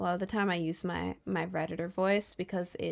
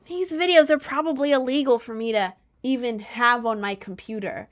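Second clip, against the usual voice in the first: higher, louder put-on voice; mocking voice; 3 semitones higher, 5 dB louder than usual. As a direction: sneer like a smug troll. Mocking the trolls' thinking.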